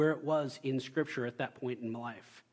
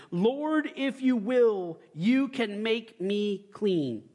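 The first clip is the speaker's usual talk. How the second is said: slow, higher begging plea; pleading leper; 6 semitones higher, 4 dB louder, much slower than usual. Acted. Leprous man on his face begging Jesus, slow pleading voice. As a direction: beg slowly, stretching each word.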